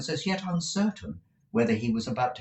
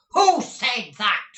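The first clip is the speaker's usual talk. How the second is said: slow, loud, higher demand; menacing demand; 9 dB louder, much slower than usual. Acted. Squeers menacing the boys to name the speaker, slow and loud. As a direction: drag each word out as a threat.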